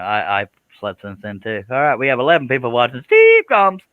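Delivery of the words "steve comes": loud high-pitched mock announcement; called out. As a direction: shout the name out big and high. Calling out the name mid-show.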